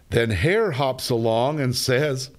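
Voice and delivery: plain reading voice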